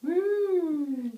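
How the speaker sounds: loud, high whoop; excited whoop. Real, not acted. Groggy patient cheering at himself.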